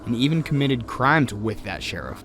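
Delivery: measured, even voiceover read; documentary narration. A narrator's reading.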